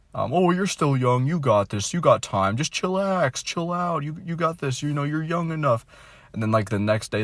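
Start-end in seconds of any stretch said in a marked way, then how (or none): 0.3-5.9 higher, breezy mock reassurance; mock reassuring people. Vlogger mocks people telling him he's young, light mock breezy voice. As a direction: parody the "chill, you're young" crowd. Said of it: "They'll say".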